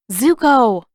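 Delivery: loud, sharp call of his name. Acted. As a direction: snap his name to stop him. Katara calls him sharply.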